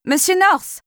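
high, urgent call of the name. Acted. Calling the owner's name. (appel pressant et aigu)

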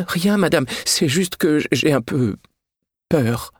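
high, hesitant, stumbling over the words; timid fear. Acted. Maid confessing to her mistress she is afraid, high hesitant voice. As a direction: admit it in a small scared voice.

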